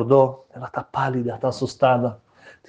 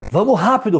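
high, louder, impatient push; hurrying father; 9 semitones higher, 3 dB louder than usual. Acted. Bride's father hurrying the rabbi along, high and impatient. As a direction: rush everyone along, pitched up.

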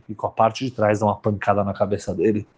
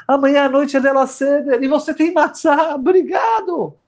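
rejoicing before the Seder; ecstatic thanks. Acted. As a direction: shout thanks in joy. Tzadik thanks him excitedly.

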